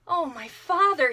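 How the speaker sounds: loud joyful call to her father. A narrator's reading. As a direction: call out to him in wonder. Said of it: "she called".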